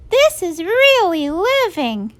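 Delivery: put-on high, gleeful child voice; high-pitched happy schoolkids; delighted kids. A narrator's reading. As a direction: revel in freedom like a kid. Kids enjoying their escape.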